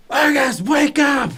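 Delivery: put-on high urgent shout to a sleeping kid; yelled wake-up. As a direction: call him awake at full voice. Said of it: "and I yell".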